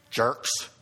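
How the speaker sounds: low muttered insult, imitating a parent; mock-grumpy dad after the guests leave; grumbling parent. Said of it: "did my mom and dad go".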